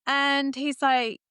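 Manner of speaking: usual speaking voice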